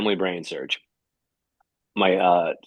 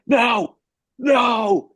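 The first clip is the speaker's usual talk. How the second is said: high, loud mock cry of protest; hammed-up panicked yelp; panicked refusal; 12 semitones higher, 5 dB louder than usual. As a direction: act out sheer panic, voice jumping up. Acting out his panic at the countdown.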